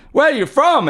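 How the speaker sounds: high-pitched mock yell. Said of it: "super loudly".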